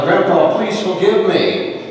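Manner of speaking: shouted plea. Retold or imitated. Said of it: "and shouted".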